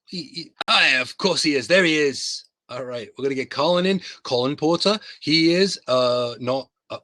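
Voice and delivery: ordinary delivery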